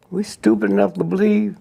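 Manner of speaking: ordinary delivery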